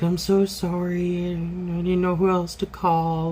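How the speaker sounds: lightly voiced apologetic friend. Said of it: "she was like".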